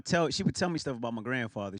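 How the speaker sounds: ordinary delivery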